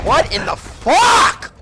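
high, drawn-out shout of disbelief; shocked shout. Real, not acted. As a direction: yell it out in total shock. Shocked outburst at game.

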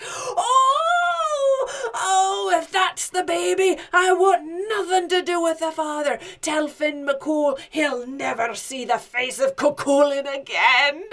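loud, high pained yelling; screaming in pain. Acted. Bitten giant swearing never to return, screaming. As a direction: yell it out, acting in agony. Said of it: "Cuchulainn screams".

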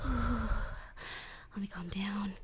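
soft, slow whisper after a sigh. Acted. Captor forces herself calm before captive, slow sighing whisper. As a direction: sigh and steady yourself, slowly.

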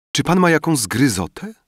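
put-on young girl asking with concern. A narrator's reading. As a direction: ask gently as a worried young girl. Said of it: "spytała Paulina".

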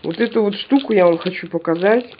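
ordinary talk, nothing marked

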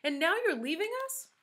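high-pitched, indignant complaint; shouted protest. A narrator's reading. Damien upset that his teacher is leaving, shouting. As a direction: keep protesting high, rising to a hurt question. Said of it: "he shouted".

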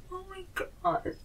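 high, slow hushed gasp; suddenly hushed. Acted. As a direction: drop to an awed hush. Hush after loud outburst.

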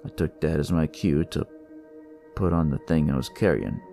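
plain reading voice

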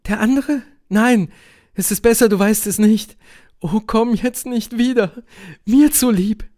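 high, urgent woman's voice; pleading Helene. A narrator's reading. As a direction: beg him to stay away. (bittende Helene, hohe dringliche Stimme)

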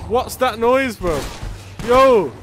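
loud, high panicked yells at teammates; excited yelling. Real, not acted. Gamer yelling to his squad about the ram. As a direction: yell in alarm as the ram charges.

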